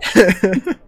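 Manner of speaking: laughing repeat. Real, not acted. Co-host repeats word, laughing.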